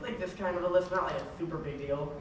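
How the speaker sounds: demonstrating a mild lisp; softly spoken, understated lisp; slight lisp. Retold or imitated. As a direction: show just a touch of lisp. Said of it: "how much of a lisp?".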